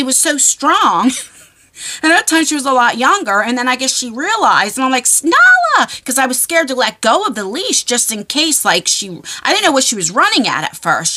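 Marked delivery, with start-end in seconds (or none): 5.2-5.9 high, loud yell of the dog's name; calling the dog. Retold or imitated. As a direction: shout after a runaway dog.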